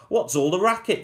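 high annoyed call; crying out. Acted. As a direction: call out from the kitchen. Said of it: "Cindy cried".

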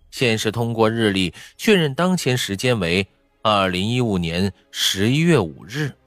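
usual speaking voice

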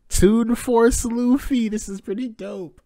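high stretched hype; high excited praise. Real, not acted. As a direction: gush over the art. Excited at color panel.

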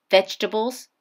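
plain reading voice

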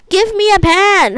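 frustrated shout. Real, not acted. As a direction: yell it at the game in frustration. Begging game for a pan.

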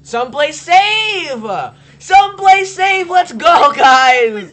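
urgent shout. Real, not acted. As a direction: yell for the group to run to safety. Fleeing monster, calling to friends.

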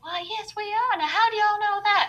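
put-on a friendly Southern accent, pitched high. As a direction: play a sweet Arkansas tourist with a Southern accent. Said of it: "soft Southern accent".

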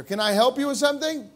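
normal talking voice

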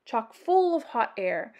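ordinary delivery

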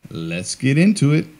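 ordinary delivery

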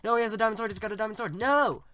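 high, loud yell of alarm; alarmed gamer yelling, peaking on the last word; panicked shouting. Real, not acted. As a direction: cry out in real panic. Alarmed at opponent's diamond sword.

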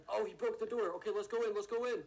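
loud high rush; excited. Real, not acted. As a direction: urge them in, loud and high. Door broken, rushing in.

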